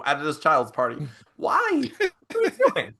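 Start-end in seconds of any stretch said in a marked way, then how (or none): 1.4-2.9 high, incredulous whine; mock disbelief, pitched high; exasperated. Real, not acted. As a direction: plead with Dad to stop talking about death. Exasperated at dad's death talk.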